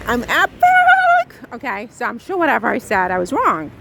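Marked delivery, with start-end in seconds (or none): 0.1-1.4 high, slow, loud squeal; gleeful boast. Pretend. Proudly calling herself epic to viewers, a slow high squeal.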